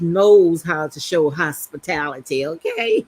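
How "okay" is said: playful okay. Real, not acted. Teasing wrap-up after hospitality claim.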